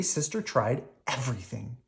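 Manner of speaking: plain reading voice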